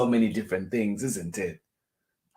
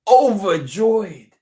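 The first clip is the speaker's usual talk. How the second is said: much higher and louder, slow and drawn out; excited question; 10 semitones higher, 11 dB louder, slower than usual. Pretend. Host cheering the word to children, excited. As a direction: burst out with joy on the word.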